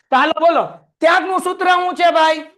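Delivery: loud call for the class to answer; rally the students, loud and high. Real, not acted. Teacher calling out to the class for an answer, loud.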